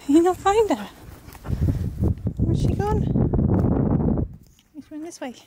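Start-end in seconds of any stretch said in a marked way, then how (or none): none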